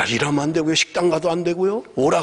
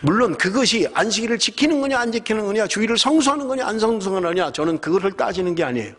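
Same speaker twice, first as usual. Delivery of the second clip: impassioned preaching; 6 semitones higher than usual. Acted. Preaching at full force.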